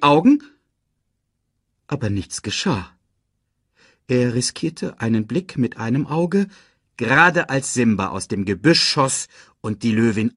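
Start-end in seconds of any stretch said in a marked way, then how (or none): none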